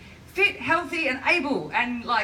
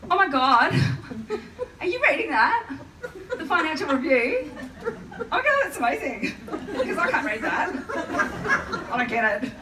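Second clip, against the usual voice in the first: high, bubbly, breathless airhead voice; giggly, much higher ditzy gush; ditzy crew member; 6 semitones higher than usual. Retold or imitated. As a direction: gush like a clueless flight attendant. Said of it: "A very enthusiastic crew member bounced over".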